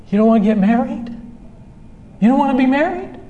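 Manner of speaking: mock incredulity, pitch climbing; incredulous spouse. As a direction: act out a spouse taken aback. Acted hurt spouse questioning reluctant partner.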